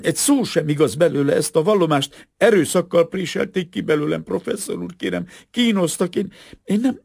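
very high, loud desperate protest; hysterical pleading, near sobbing. A narrator's reading. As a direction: plead frantically until the words break off. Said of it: "Kitört belőle újra az okogás". (kétségbeesett, sírásba forduló tiltakozás)

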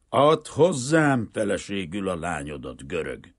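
a touch of the business dictator; haughty, hard. A narrator's reading. Banker demanding the Greek's daughter as wife, haughty and hard. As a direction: open loudly and firmly, like playing a trump card. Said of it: "döjfösen, keményen, mint a vereséget nem ismerő üzleti diktátor".

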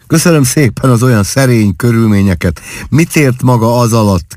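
lightly teasing, a little mocking; playful mockery. A narrator's reading. Husband mocking his bride's modest upbringing, playfully scornful. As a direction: needle her with playful scorn. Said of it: "kérdé pajkos gúnnyal".

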